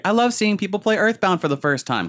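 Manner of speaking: high gushing fan voice; excited fan voice. Retold or imitated. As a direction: gush like an eager fan. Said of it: "was like".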